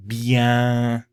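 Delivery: teacher showing the nasal vowel, pushed through the nose; nasal vowel demo. Shown as a demonstration. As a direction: hold the nasal "eh" of "Bien". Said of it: "has a nasal vowel with it".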